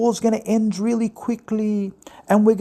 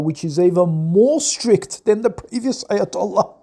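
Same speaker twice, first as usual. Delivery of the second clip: ordinary talk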